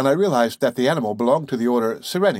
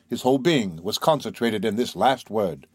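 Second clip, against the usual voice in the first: usual speaking voice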